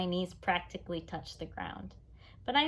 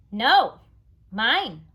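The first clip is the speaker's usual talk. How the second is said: put-on loud, high, grabby little kid; stubborn toddler; 3 semitones higher, 12 dB louder than usual. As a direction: clutch it and refuse. Toddler refuses to give bike.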